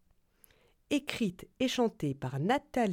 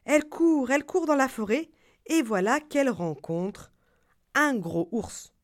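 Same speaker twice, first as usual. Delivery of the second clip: usual speaking voice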